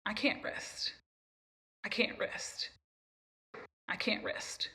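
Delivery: whispered, repeated to herself; whispered inner voice. Retold or imitated. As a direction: whisper it like a restless thought. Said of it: "And I was like".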